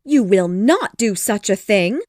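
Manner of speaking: loud, indignant wife; outraged wife character voice; angry. A narrator's reading. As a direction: forbid him angrily. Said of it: "cried his wife angrily".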